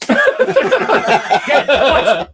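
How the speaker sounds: high, loud call breaking into laughter; laughing call. Retold or imitated. Son calling dad during the fight, laughing call. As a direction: shout for dad while cracking up.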